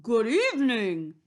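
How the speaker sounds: loud, showily courteous wolf greeting; hearty, mannerly wolf; polite Big Bad Wolf. A narrator's reading. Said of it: "said Big Bad Wolf politely".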